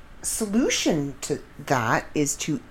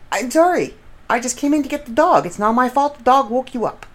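exasperated protest; 12 semitones higher, 3 dB louder than usual. Retold or imitated. Defending herself to glaring mom.

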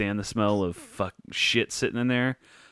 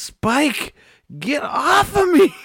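put-on high, loud, slow little-girl shout; toddler shouting at the dog; 8 dB louder, much slower than usual. Father imitating his toddler yelling at the dog, high and loud. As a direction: shout it like a half-asleep toddler shoving a big dog. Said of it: "She goes".

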